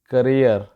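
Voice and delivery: करियर with a short first vowel, stress on the second syllable; correct pronunciation. Shown as a demonstration. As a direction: say करियर as kuh-REER. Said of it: "actual इसकी जो pronunciation है".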